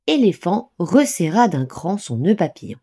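ordinary delivery, nothing marked

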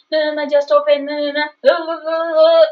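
drawn-out, monotone mock wail; mock crying. Boyfriend crying to be let in, imitated as a wail. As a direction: imitate pleading sobs, stretching the last sound. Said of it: "So he cries there".

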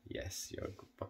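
whispered praise. Real, not acted. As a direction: breathe the praise gently to the dog. Praising dog softly.

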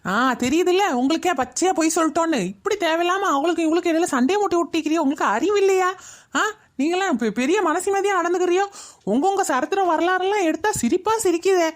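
high-pitched, accusing tirade without shouting; indignant scolding. Acted. Accusing two women of lying and stirring fights, indignant scolding. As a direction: tell them off in rising indignation.

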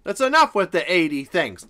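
ordinary delivery

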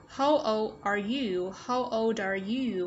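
put-on slow "how old are you", word by word; stretched teacher's model of "how old are you"; careful model question. Shown as a demonstration. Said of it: "Đây chúng ta sẽ nối âm".

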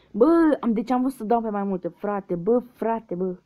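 loud, high exasperated yell; frustrated outburst. Real, not acted. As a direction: blurt out annoyance at a lost round. Wrong answer, frustrated outburst. (izbucnire frustrată, tare și sus)

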